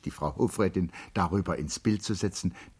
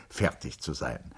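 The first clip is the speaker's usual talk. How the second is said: ordinary delivery, nothing marked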